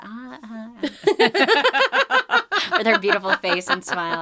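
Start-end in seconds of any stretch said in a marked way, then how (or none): 0.1-2.4 rising stammer into a laugh; imitating stammer. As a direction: stall awkwardly then laugh. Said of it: "and she's like".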